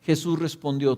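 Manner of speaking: plain reading voice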